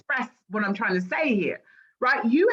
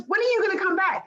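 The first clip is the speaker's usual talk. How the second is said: higher, faster mock friend; friend pleading; 9 semitones higher, faster than usual. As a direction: imitate the friend begging her back, high and quick. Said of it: "she goes".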